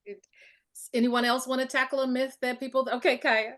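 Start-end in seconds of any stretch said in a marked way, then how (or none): none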